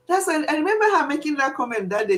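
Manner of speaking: ordinary talk, nothing marked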